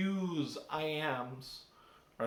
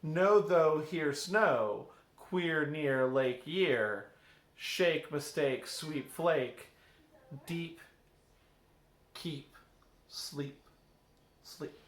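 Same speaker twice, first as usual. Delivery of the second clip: slow list of rhymes fading away to nothing; rhyme words listed; much slower than usual. Shown as a demonstration. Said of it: "so if I say".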